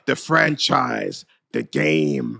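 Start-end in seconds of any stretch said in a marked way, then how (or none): 1.5-2.4 lower, gravelly-named "the game" in a lightly put-on voice; a hint of a rasping wrestler's growl; mock Triple H. Mocking Triple H's new voice to friends, lightly rasped. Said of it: "he's got a rasp in his voice".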